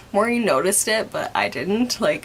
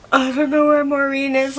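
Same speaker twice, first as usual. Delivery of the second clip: mildly panicked, pitched up; retold panic; 5 semitones higher than usual. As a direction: replay a worried phone call with a touch of panic. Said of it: "Like, I was panicking".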